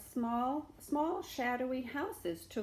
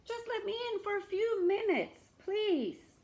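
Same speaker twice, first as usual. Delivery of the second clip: much higher, pleading; pleading beggar; 12 semitones higher, 5 dB louder than usual. Acted. Beggar begging to come in, pleading. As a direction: plead through the door.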